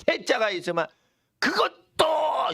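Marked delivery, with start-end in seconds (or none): 1.4-2.5 "그것도" cried high and drawn out; emphatic inclusion. Shown as a demonstration. Preacher explaining the particle's force, emphatic inclusion with "그것도" pitched high. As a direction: punch "그것도" high and slow. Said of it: "이런 뜻이에요".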